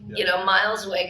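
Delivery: usual speaking voice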